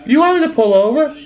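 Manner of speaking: put-on high, loud, fast scolding baby voice; hammed-up Stewie yelling from the front seat; imitating Stewie. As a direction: threaten like an angry parent in the car. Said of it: "And Stewie goes".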